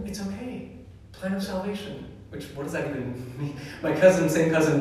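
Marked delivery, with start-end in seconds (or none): 0.1-2.1 higher, sing-song mock comfort; mock platitude. Church folks comforting a grieving friend, mocking higher singsong. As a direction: parrot the empty consoling line. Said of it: "what everybody said to all of us".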